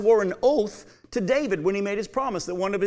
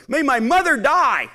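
higher, louder curse; desperate curse; 6 semitones higher, 7 dB louder than usual. Acted. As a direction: fling the oath out. Peter calls down curses.

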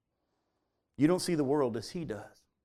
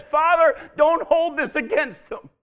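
high, forceful cry to heaven; loud plea; 12 semitones higher, 11 dB louder than usual. Retold or imitated. Preacher as Stephen falling under the stones, loud pleading cry. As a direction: cry it out slowly at full voice.

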